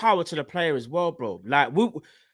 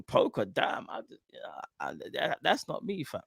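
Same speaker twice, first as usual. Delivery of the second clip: mock timid mutter; 2 semitones lower, 10 dB quieter than usual. Diaz's nervous thoughts, voiced.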